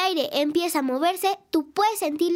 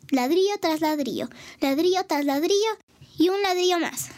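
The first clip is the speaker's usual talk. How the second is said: put-on storytelling to kids. Host reading a story to children at home, lively narration. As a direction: tell the tale to little listeners with gusto.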